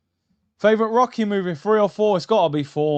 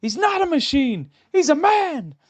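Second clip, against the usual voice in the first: higher, urgent corner-man pep talk; Rocky's trainer; 9 semitones higher than usual. Retold or imitated. Trainer urging Rocky between rounds, higher and urgent. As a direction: fire up your boxer between rounds. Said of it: "the guy to Rocky is like".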